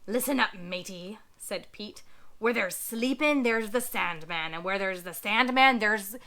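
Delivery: a greedy pirate chanting his logic. Acted. Pirate Pete voice.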